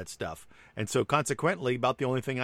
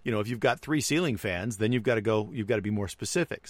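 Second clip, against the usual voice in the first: normal talking voice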